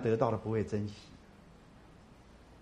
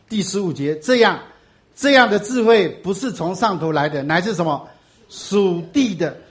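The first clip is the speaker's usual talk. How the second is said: full-force preaching; 4 semitones higher, 7 dB louder than usual. Acted. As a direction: thunder the scripture at the congregation. Preaching loud to congregation. (高声慢速讲道)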